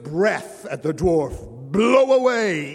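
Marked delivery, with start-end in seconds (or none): 1.7-2.7 loud, higher bellow. A narrator's reading. Strongman bellows at the dwarf. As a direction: blast it out at him. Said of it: "he bellowed".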